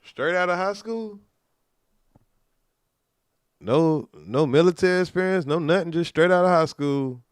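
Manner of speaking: incredulous. Real, not acted. Incredulous at rookie cop.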